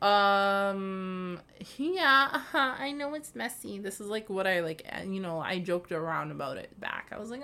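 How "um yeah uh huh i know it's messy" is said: fake-agree with an eye-roll tone; sarcastic agreement.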